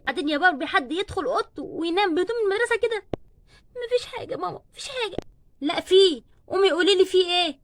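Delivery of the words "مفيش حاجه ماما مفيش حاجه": high, soft and small; tearful denial. Acted. Crying daughter tells her mother nothing is wrong, high and soft. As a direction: hold back tears.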